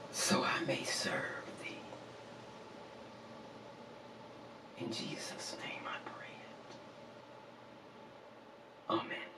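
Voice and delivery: whispered close of a prayer; very soft, breath-only amen; hushed prayer. Real, not acted. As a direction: let the prayer fall away to a reverent whisper.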